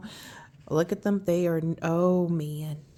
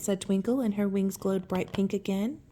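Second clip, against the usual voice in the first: ordinary delivery, nothing marked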